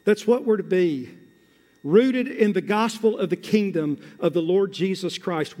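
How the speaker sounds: ordinary delivery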